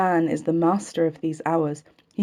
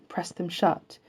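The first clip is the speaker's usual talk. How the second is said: plain reading voice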